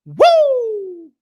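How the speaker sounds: long, high falling "woo"; celebratory holler; excited whoop. Real, not acted. Whoops after hyped prediction.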